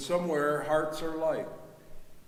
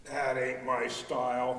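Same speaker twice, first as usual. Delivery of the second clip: Casey's voice. A narrator's reading. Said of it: "said Casey".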